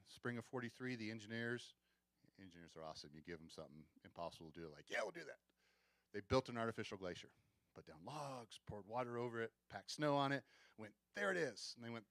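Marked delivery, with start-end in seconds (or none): 4.9-5.4 high, gung-ho quote of keen engineers; eager agreement. Acted. Engineers accepting an impossible task, eager and high. 11.1-11.7 high, louder mock-triumphant quote; proud announcement. Engineers showing off their artificial glacier, proudly announcing it.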